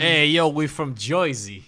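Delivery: slow, hammed-up Jersey drawl; thick Jersey accent. Playing New Jersey diners, slow mock Jersey accent. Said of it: "they had a very thick Jersey accent".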